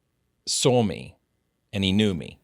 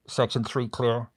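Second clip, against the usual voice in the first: imitated radio call, slow and raised; mock two-way radio report; walkie-talkie voice; 3 semitones higher, slower than usual. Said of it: "a walkie-talkie".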